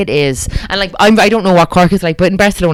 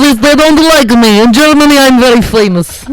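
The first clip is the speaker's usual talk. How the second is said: put-on slow, loud, high hammy German accent; bad German accent; 7 semitones higher, 7 dB louder than usual. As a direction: overdo a German accent, cracking up. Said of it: "I'm so bad at accents".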